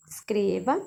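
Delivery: ordinary talk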